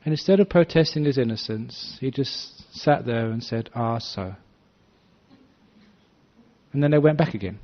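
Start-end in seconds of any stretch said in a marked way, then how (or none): 3.8-4.3 soft, even murmur, unbothered; calm acceptance. Retold or imitated. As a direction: let it fall gently, nothing to defend. Monk calmly accepts accusation.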